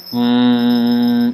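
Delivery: short nasal hum, tongue back; velar hum. Shown as a demonstration. Teacher demonstrating velar nasal with a hum. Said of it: "velar resonant".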